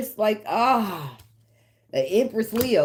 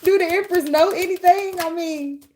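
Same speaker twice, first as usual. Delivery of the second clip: much higher and louder, worked-up question; excited outburst; 12 semitones higher, 6 dB louder than usual. Real, not acted. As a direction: fire it off all riled up. Excited rant during tarot reading.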